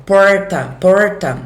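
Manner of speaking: São Paulo interior accent, curl the R in porta. Shown as a demonstration. Teacher demos the São Paulo interior R. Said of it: "No interior de São Paulo".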